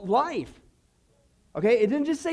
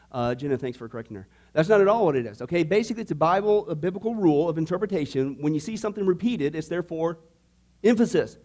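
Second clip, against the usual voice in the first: ordinary delivery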